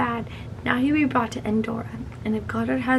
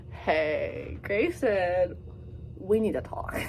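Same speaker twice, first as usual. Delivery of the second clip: AI voice; 4 semitones lower, 6 dB quieter, slower than usual. Retold or imitated. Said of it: "The Thunderhead's like".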